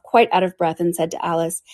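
ordinary delivery

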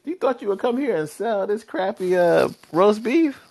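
high, loud mocking voice, slowing on roast beef; the city's voice mocking someone who sold crappy roast beef; mocking sneer. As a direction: jeer at the clueless food seller. Mocking the seller of bad food.

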